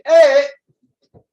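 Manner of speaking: sudden high shout; loud, long hey to get attention; God calling out. Retold or imitated. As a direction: shout as if waking someone. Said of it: "yo sentí que Dios decía".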